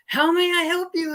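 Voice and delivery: high, loud call-center greeting; loud phone greeting. Retold or imitated. Call-center lady greeting callers too loudly. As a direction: belt it across the room. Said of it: "naturally loud voice".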